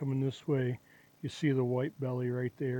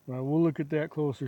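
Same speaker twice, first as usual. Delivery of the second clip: normal talking voice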